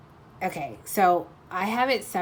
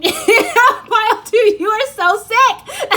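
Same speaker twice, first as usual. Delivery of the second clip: much higher, slow, laughing; 9 dB louder, much slower than usual. Real, not acted. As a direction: squeal it through laughter. Laughing at the listener.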